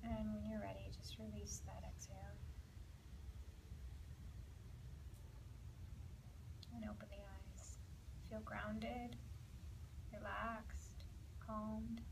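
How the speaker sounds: hushed relaxation cue; guided breathing. Acted. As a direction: ease the class down into the hips and legs.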